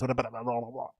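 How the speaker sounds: rattled-off gibberish imitation; babbled blahs, mimicking a speed-talker; mock fast talker. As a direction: imitate someone speaking really fast. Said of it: "speaks really, really fast".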